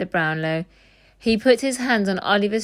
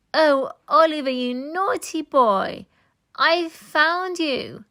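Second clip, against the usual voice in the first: high, slow, sugary false tenderness; Nancy faking a loving sister for the crowd; high, slow, falsely sweet; silky false voice; 9 semitones higher, slower than usual. A narrator's reading. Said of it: "in a silky false voice".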